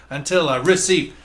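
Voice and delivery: "receive" with both syllables hit, RE-CEIVE; stress on both syllables. Retold or imitated. Imitating the band for co-hosts, "receive" stressed on both syllables. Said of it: "emphasis on both syllables the way they sing it".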